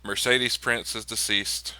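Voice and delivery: usual speaking voice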